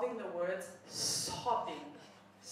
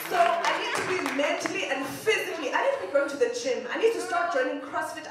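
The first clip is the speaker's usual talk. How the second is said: rising excitement; 6 semitones higher, 8 dB louder than usual. Acted. Comic escalating list of plans.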